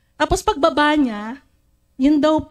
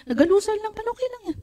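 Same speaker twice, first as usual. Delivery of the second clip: sweet reassurance; 10 semitones higher, much faster than usual. Retold or imitated. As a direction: brush it off tenderly. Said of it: "Sabi kong ganun". (malambing at mahinang pag-alo)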